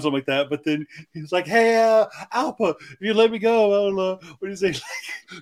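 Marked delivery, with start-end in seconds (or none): 1.5-5.4 higher, louder wheedling bribe; desperate pleading. Retold or imitated. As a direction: beg sleazily, building up. Quoting a desperate bribe line.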